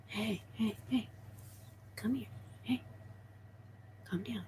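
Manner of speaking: very soft, coaxing murmur; hushed pet soothing. Real, not acted. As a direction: settle the restless pet quietly. Settling the restless snake.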